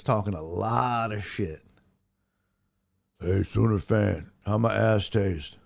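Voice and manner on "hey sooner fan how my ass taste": low, taunting celebrity impression; deep-voiced impression, jeering at a rival fan; Shaq. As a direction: drop the pitch way down and taunt. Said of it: "That was my Shaq impression".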